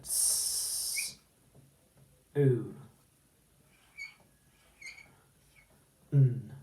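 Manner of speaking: slow separate sounds s, oo, n; sounding out. Shown as a demonstration. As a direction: sound out S, oo, n one by one. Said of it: "we say the sounds to build the word".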